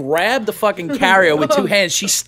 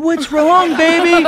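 very high mock baby talk; syrupy put-on sympathy; cooing clinic staff; 11 semitones higher, much slower than usual. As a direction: coo at a sick pet. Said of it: "all the people like".

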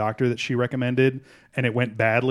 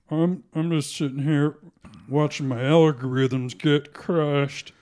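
Kermit the Frog straining for a deep voice; slow, put-on froggy voice, mock self-pity; Donald Trump Junior; 3 dB quieter than usual. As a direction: do Kermit faking an extra deep voice. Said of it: "Kermit the Frog was trying to put on, like, an extra deep voice".

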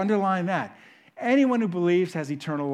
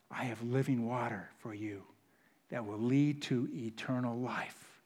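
soft, tender, much quieter; 7 semitones lower, 11 dB quieter than usual. Acted. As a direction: offer it gently to the woman at the well. Quiet tender voicing of Jesus.

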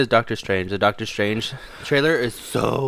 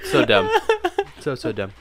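laughing. Real, not acted. Host laughing at co-host's bottle antics, chuckling. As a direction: say it through a real chuckle.

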